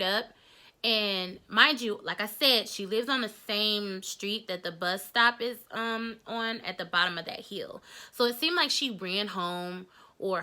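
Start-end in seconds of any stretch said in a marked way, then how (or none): none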